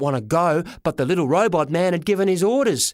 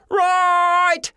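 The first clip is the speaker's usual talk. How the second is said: high loud shout; yell; 13 semitones higher, 5 dB louder than usual. A narrator's reading. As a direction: yell it out in panic. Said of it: "I yelled".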